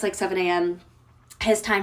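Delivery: usual speaking voice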